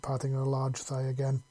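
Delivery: ordinary delivery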